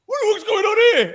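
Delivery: high, loud startled shout while laughing. Retold or imitated. Woken festival-goer shouting at a stranger in the tent, startled. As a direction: yell out in alarm half-laughing. Drunk guy climbing into tent.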